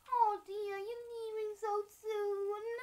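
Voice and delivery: high, slow, drawn-out granny voice; doting granny. Pretend. Granny to departing grandchild, high doting granny voice. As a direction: coo it high and stretch the last word. Voicing Granny in pretend play.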